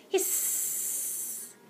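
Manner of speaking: long unvoiced hiss; cats hissing. Cats circling the pair, hissing.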